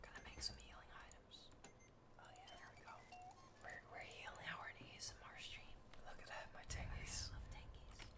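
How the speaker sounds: soft breathy ASMR whisper into the mic; ASMR whisper for the stream. Acted. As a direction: whisper close and intimate like an ASMR video.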